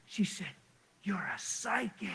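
hushed, slow amazement, half whispered; soft, astonished near-whisper; retold awestruck surprise. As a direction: imitate her stunned reaction, softly, with pauses. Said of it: "she was fired up".

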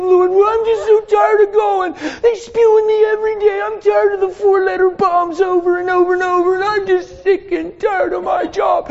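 high, whining mock prayer; a hint of a whiny believer pleading; complaining prayer. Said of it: "If God fathered you that way".

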